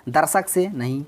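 ordinary talk

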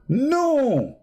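mock shout. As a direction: shoot the question down with a shouted no. Mock answer to his own question.

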